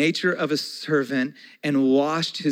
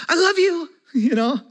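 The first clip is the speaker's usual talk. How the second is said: put-on much higher, louder childlike gush; gushing child; 13 semitones higher, 7 dB louder than usual. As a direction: squeal it like a little kid. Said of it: "I'm like".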